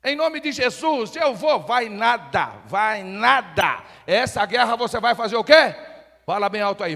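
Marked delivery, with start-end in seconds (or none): 0.0-1.6 mock bravado. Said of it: "você acha que ... você tem que lutar".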